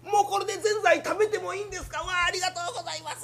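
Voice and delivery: high and fast, voice breaking with delight; overjoyed. Acted. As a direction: gush like you've been given a prize. Patient overjoyed.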